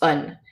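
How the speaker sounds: "un" with a very short "uh" vowel; short unaccented A. Shown as a demonstration. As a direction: say "un" small and unaccented. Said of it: "an unaccented A by itself is usually just".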